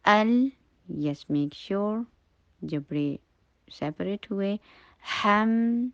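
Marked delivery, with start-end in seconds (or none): 0.0-0.5 higher, louder held syllable; slow demonstration recitation. Shown as a demonstration. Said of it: "آپ دیکھیں، ایسے پڑھیں گے". 5.2-5.9 higher, louder held syllable; slow demonstration recitation. Shown as a demonstration. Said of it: "آپ دیکھیں، ایسے پڑھیں گے".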